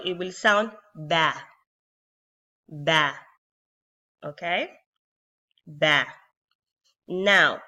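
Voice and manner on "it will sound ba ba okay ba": "ba" said short with the open a; a crisp short "Ba"; modelling ba with fatha; ba syllable. Shown as a demonstration. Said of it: "how will it sound".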